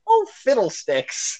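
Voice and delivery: high, slow, prissy mock voice; hammed-up goody-goody chaperone; prim chaperone. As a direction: mince it out like a prim church lady. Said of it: "he'd be like".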